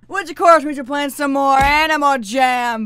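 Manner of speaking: loud, high hype-up; hyped. Real, not acted. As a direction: shout it like a hype man. Hyped announcing Jammin' Thursday.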